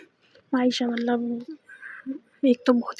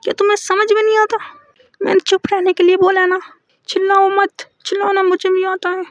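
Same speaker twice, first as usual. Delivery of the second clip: much higher and louder, fast angry yell; loud outburst; 6 semitones higher, 7 dB louder than usual. Retold or imitated. Maysha shouting at her girlfriend to shut up. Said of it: "Maysha बहुत ज़ोर से बोलती है".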